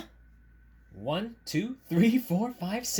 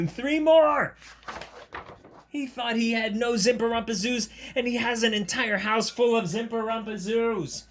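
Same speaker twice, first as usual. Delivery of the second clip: high, emphatic storybook narration; excited story reading; 8 semitones higher, 5 dB louder than usual. A narrator's reading. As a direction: read it out in mounting amazement, high-pitched.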